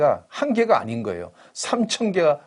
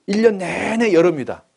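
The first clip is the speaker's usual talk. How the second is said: dramatic exclaim; 6 semitones higher, 6 dB louder than usual. Acted. Dramatic hypothetical to congregation.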